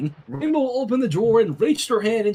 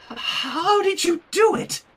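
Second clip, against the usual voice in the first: high, slow astonished question; amazed; 11 semitones higher, slower than usual. Acted. Sunset stunned at Rainbow's success, high and slow. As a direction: drag it out in disbelief.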